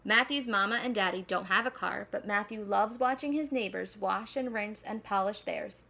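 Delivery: ordinary delivery, nothing marked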